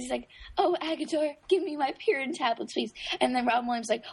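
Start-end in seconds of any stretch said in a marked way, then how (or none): 0.5-2.9 high-pitched, campy plea; Albert. Retold or imitated. Quoting a favourite scene to a friend, high camp voice. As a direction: imitate a flamboyant diva begging for pills. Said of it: "I love when Albert says".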